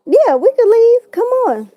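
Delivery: tipsy eager voice. Retold or imitated. Retelling drunk self agreeing.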